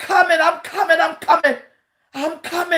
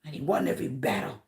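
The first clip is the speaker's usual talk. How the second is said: hushed closing prayer; 9 semitones lower, 6 dB quieter than usual. Acted. Pastor blessing weeping viewers, in a hushed whispered prayer. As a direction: draw them close and pray over them quietly.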